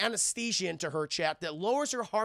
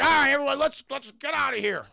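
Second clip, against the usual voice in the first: mock rallying call; 8 semitones higher, 6 dB louder than usual. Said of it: "they're like".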